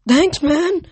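high loud shout of thanks; relieved yell over the wind. A narrator's reading. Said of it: "Leo yelled".

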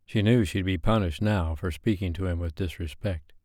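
ordinary delivery

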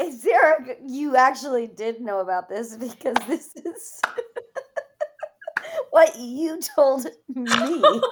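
laughing through the words; giggling while talking. Real, not acted. Laughing through teasing reply.

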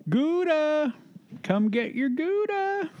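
very high, drawn-out call of GOUDA; singsong pet call. Real, not acted. Calling a pet to come and get it, high drawn-out call.

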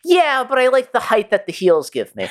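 high, lightly voiced Bugs; Bugs Bunny line. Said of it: "And he was like".

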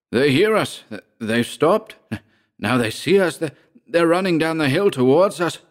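breaking news to a fellow victim, excited. A narrator's reading. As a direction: call out the rescue with rising excitement. Ulrich sees rescuers running closer.

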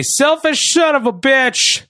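high-pitched, hammed-up insult; mock angry yell. Host imitating a wrestler yelling at his boss backstage, mock angry yell. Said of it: "Rollins yells at him backstage".

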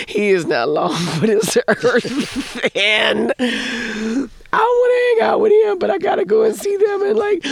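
loud, high, drawn-out wailing; mock cry fit. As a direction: build from a tearful protest to an exaggerated wail. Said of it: "like, a cry fit in the back".

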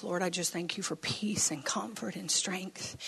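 breathy hushed prayer under the breath; a secret whispered thank-you to the Lord. Retold or imitated. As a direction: pray silently so nobody notices. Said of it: "I'm like".

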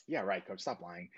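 soft, dismissive brush-off; retold disbelief, dropping away quietly; shrugging it off. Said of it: "I shrugged off like".

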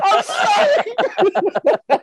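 very high, slow laughing whimper; mock whimpering. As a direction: stretch it out high through laughter. Whimpering apology in story.

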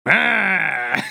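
comic monster noise; Frankenstein groan. Retold or imitated. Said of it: "Smokescreen makes Frankenstein noises".

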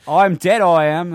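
loud, high mock Australian; Australian accent. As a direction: belt out a hammy Australian accent. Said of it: "I can't do an Australian".

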